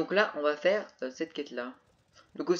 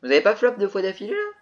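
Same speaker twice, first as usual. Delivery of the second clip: exclamation surprise, plus haute et forte; s'étonner à voix haute; surpris; 6 semitones higher, 11 dB louder than usual. Real, not acted. Surpris par deux échecs. (incrédule, voix forte)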